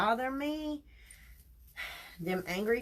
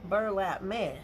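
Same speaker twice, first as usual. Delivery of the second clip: usual speaking voice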